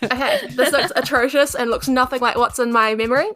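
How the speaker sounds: ordinary delivery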